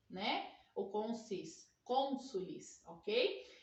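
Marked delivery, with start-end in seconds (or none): none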